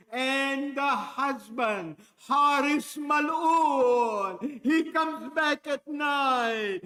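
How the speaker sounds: slow, loud pulpit cry on the villain's name; preaching build. Acted. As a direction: stretch out Haris Mal'un like a condemnation.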